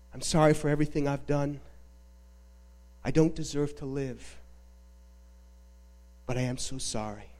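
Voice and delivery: low, soft, acted apology; remorseful confession. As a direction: confess guilt quietly, voice dropped low. Dying man's confession of guilt retold.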